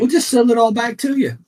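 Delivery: mock the sheriff's cheerful sales pitch. Mocking the sheriff's office.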